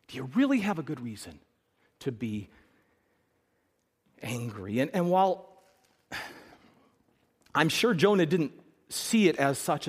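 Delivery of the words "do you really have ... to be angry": quiet, drops lower to the end; soft probing question. Acted. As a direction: gently press him for an answer. God presses Jonah, softly.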